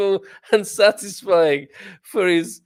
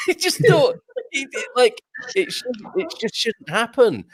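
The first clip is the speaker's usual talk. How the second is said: much higher, laughing through the words; 10 semitones higher than usual. Real, not acted. Finishing the uncle story while laughing. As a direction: still giggling at the memory.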